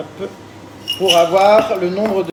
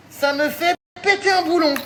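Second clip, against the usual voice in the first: exasperated; 12 semitones higher than usual. Real, not acted. Exasperated about sticky bag.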